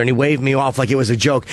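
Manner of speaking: usual speaking voice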